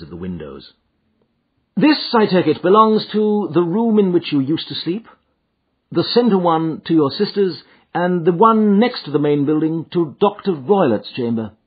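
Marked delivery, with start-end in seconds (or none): 1.8-11.5 higher-pitched detective voice; brisk, probing sleuth; Holmes, inquiring. A narrator's reading. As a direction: check each deduction with the client. Holmes questions his client.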